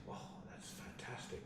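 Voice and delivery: whispered mum on the phone; hushed. Retold or imitated. Mum whispering her delight down the phone. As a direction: breathe it out softly like an awed mum.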